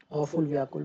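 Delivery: usual speaking voice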